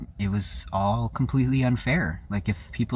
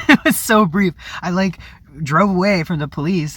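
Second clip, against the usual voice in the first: much higher and louder, laughing through the words; laughing disbelief; 10 semitones higher, 7 dB louder than usual. Real, not acted. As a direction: giggle through the memory in disbelief. Laughing while recalling the chase.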